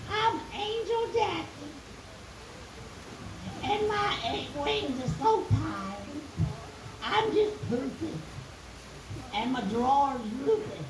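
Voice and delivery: very high, small tired angel voice; little angel voice. Acted. Jackie the angel talks to Hillary in a high tired squeak.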